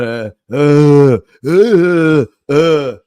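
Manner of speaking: loud mock groans of a man in pain; retelling the groans of the patient in the next bed; imitated pained groaning. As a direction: groan it out like a patient who can't sleep. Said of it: "in bed all night going".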